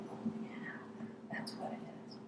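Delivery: soft whispered realization. Pretend. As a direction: breathe it as a discovery. Quiet realization in meditation.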